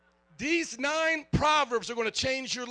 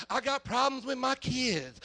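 lightly voiced whiny parent; a hint of a fed-up parent's gripe; imitating a complaining parent; 2 semitones higher than usual. Churchgoer complaining to the pastor, a hint of a whine. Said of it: "people come to me and they say".

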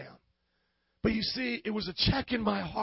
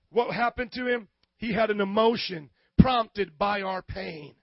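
ordinary talk, nothing marked

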